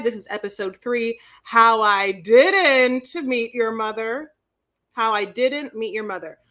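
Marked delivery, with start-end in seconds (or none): 1.4-4.3 title read. Shown as a demonstration. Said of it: "do the title of this for me".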